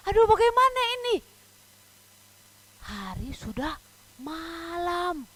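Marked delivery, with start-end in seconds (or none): 0.1-1.2 high, fast acted wail of dismay; hammed-up helpless exclamation; dismayed boatmen. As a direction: fret in a rush, high-pitched. Voices tired boatmen's despair.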